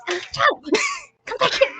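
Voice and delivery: high, strained call; calling after someone. Acted. Short-legged pursuer calling after someone pulling away, high strained call. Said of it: "she's like".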